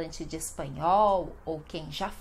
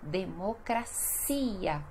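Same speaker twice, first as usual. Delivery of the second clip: "Democracia" slowly, de-mo-cra-SI-a; stress on CI; 4 dB quieter, slower than usual. Shown as a demonstration. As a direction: break up "Democracia" and lengthen CI. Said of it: "sílaba mais forte".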